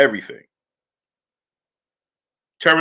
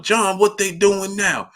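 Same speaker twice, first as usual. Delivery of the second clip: imitating uncle asking grandfather; 6 semitones higher than usual. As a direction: nag John for the play-by-play. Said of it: "my grandfather's name was John".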